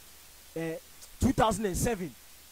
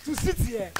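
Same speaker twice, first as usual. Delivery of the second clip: 1 laughing shout; 10 semitones higher, 12 dB louder, slower than usual. Real, not acted. Mock-invites colleague to lie.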